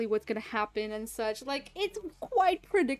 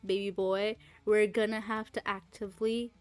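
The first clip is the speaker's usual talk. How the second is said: lower, softer re-enacted coaxing; earnest heart-to-heart; 4 semitones lower, 4 dB quieter than usual. Streamer having a healthy conversation with a chatbot, earnest and low. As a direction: gently reason with them, mock-serious. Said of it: "genuinely healthy conversation".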